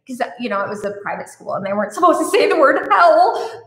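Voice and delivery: ordinary delivery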